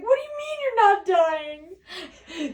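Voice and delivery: high, loud, slow panicked wail; half-laughing mock cry of shock; hammed-up shrill panic; Pepper freaking out. Pepper voiced in retold scene.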